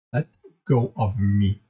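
low, slow growled warning. A narrator's reading. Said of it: "Alex growled".

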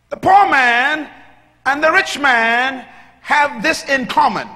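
high, loud preacher's cry; preaching at full force. Acted. Preacher telling the congregation what God said, shouting. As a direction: drive the story home shouting. Preacher reads the verse aloud.

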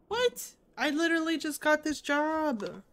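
high, loud incredulous protest; indignant disbelief. Acted. New hire protesting a huge responsibility, indignant disbelief. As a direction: blurt it out in outraged disbelief.

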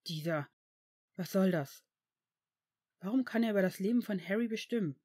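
mild, pressed anger; angry, forced out. A narrator's reading. Tom protests the director's power over Harry, angry. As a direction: force the angry question out, breaking off first. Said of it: "presste er wütend hervor".

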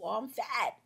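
usual speaking voice

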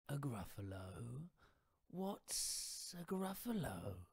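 slow, drawn-out sly snake voice; slithery snake. Acted. Snake inviting the mouse to a feast, slow and sly.

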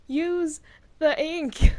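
loud slow emphatic yell; shouting. Real, not acted. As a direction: shout it so they finally hear. Repeating louder after what.

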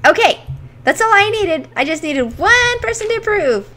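high, loud burst of glee; excited cheer. Real, not acted. Gamer cheering at chat's approval, excited. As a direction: cheer like you just got permission.

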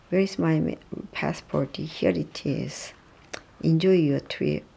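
ordinary talk

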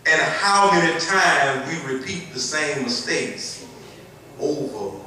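rising preacher's cadence, building loud; preaching. Acted.

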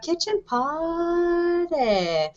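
soft, slow, stretched-out flourish; drawn-out show name. Acted. Host reads a viewer's comment, drawing out the show name. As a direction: stretch the last word out long and softly.

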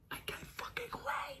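hushed excited whisper. Real, not acted. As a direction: breathe it out in secret glee.

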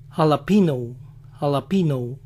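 jalapeno as alapino, plain n; jalapeno without ñ. Shown as a demonstration. Showing the spelling without ñ, plain n. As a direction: say jalapeno with a plain n, American accent. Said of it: "no lo escriben con la ñ, sino con N".

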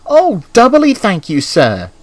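gushing, high-pitched gratitude; excited thanks. A narrator's reading. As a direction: leap high on the thanks, then drop. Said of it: "whistled Thomas excitedly".

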